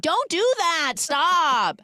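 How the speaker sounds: high, loud, drawn-out scolding; acting out a panicked telling-off; alarmed protest. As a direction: cry out to make him stop at once. Retold protest at rude gesture.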